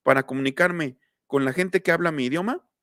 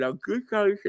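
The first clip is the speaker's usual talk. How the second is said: mock garbled words with fingers in the mouth; 6 semitones higher than usual. Teacher joking about demoing with fingers in the mouth, a garbled higher voice. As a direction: talk as if fingers were stuck in your mouth. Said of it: "meterme los dedos a la boca y decir".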